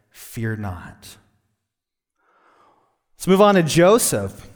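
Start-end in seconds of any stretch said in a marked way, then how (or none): 0.1-1.2 softer, hushed, calming command; calming angel. A narrator's reading. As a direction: gently soothe a frightened girl. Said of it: "the angel calms her down and says".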